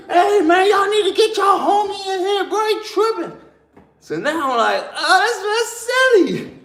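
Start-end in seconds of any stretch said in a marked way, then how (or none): 0.1-3.3 high, hollering cellmate at the door; imitated yelling. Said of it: "heard him start yelling". 4.9-6.5 amused realization. Retold or imitated. Gleeful realization about the scared cellmate.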